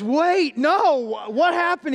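bewildered, catching up too late; little brother caught off guard, alarmed; confused kid. Retold or imitated.

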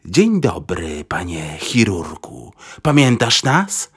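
put-on low, slow German-accented greeting; thick German accent, drawn out low. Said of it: "ich akcent był tak bardzo niemiecki".

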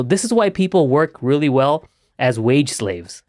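normal talking voice

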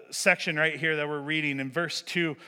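usual speaking voice

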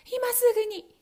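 high, loud quick reply; wife stalling Bluebeard. Acted. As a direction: call back to buy time. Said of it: "と奥さんは言いました".

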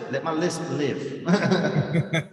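slow, laughing through the words. Real, not acted. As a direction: giggle it out slowly. Joking about own lisp.

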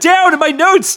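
high, thrilled squeal of agreement; excited outburst. Real, not acted. Co-host thrilled at a shared joke, excited outburst. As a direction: burst out with delighted recognition.

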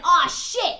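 loud retold cry of dismay; imitated shocked shout; alarmed exclamation. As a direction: act out sudden panic, loudly. Voicing man seeing her fall.

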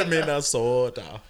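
put-on slow Minnesota accent on "Minnesota"; drawn-out Minnesota accent. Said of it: "the Minnesota".

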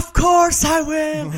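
high, loud, drawn-out battle cry; full-volume hero's shout, every word stretched; imitated heroic scream. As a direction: scream it slowly like an anime hero. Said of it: "bara skriker bara".

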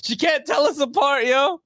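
high, laughing indignation; laughing mock outrage. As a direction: protest through laughter.

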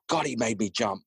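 ordinary delivery

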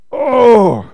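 loud, strained "oh"; loud grunt. A narrator's reading. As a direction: grunt it out loudly with effort. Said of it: "grunted Douglas".

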